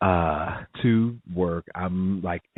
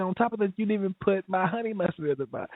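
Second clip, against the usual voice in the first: indignant complaint; 10 semitones higher, 3 dB quieter than usual. Retold or imitated. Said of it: "and then I said".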